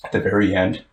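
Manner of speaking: getting choked up mid-story; choking up. Real, not acted. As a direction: voice starting to choke with feeling. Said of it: "You choking up?".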